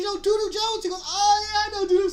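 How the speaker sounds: high, loud burst of recognition; light up as the name clicks; sudden recognition. Retold or imitated. Friend suddenly recognising the nickname, excited.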